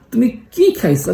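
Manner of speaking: কি lifted high and stressed. Shown as a demonstration. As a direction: ask with a high punch on কি. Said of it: "কিতে জোর দিই".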